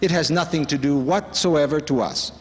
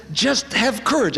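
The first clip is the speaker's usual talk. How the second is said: higher-pitched, slow, urgent encouragement; lightly acted pep talk from a teacher; Swami's encouragement; 8 semitones higher, slower than usual. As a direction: urge them on with a raised pitch. Quotes Swami urging courage.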